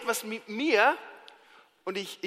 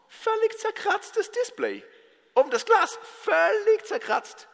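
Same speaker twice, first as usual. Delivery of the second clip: mock dismay; 11 semitones higher than usual. Buyer unpacking a scratched new watch, appalled. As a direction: draw out your outrage in a high voice.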